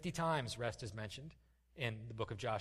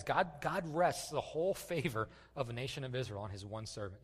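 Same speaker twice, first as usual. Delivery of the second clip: usual speaking voice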